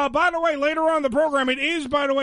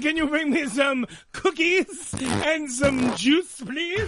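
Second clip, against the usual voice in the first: put-on British kid voice; much slower than usual. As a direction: beg sweetly in a put-on British accent. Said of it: "When did they get a British accent?".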